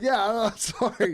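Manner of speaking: trying to apologize while giggling; giggly apology. Retold or imitated. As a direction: act out apologizing through the giggles. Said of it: "I got the giggles".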